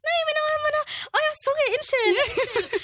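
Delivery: high, fluttery feminine apologising; girly flustered voice. Retold or imitated. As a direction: squeak out shy apologies. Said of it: "feminin vibe".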